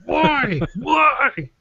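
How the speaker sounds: high mock-crying "why"; mock wail. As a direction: wail it like a fake crybaby. Mock wail about angry Canadians.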